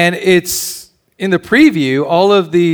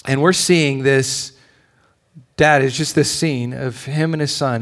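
ordinary talk

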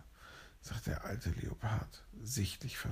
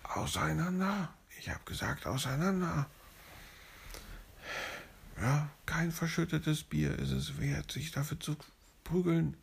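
stern police order; 6 semitones higher than usual. Acted. Police breaks up the fight.

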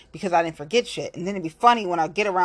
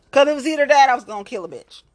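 heated outburst; 6 semitones higher, 6 dB louder, faster than usual. Real, not acted. Heated retelling.

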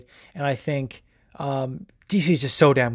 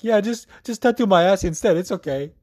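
put-on Swedish accent; 5 semitones higher than usual. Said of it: "I can't imagine him sitting there and being like".

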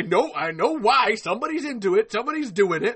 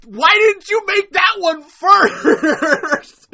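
high, loud, slowed-down disbelieving shout; exasperated shout; 13 semitones higher, 15 dB louder, much slower than usual. Real, not acted. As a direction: yell it out in mock outrage, stretching every word. Exasperated about the toy order.

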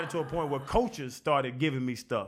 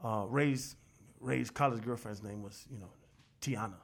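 hushed coach; 6 semitones lower, 9 dB quieter than usual. Retold or imitated. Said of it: "He was like".

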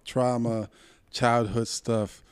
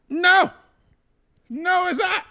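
worked-up no; 5 dB louder, slower than usual. Real, not acted. Denying the layover, worked up.

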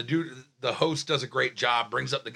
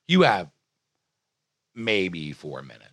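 verbatim imitation of host; 9 semitones lower than usual. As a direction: drop the voice and draw it out. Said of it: "She goes exactly like this verbatim".

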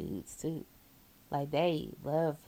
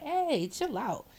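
put-on calming the drunk; 7 semitones higher, slower than usual. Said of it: "I'm like".

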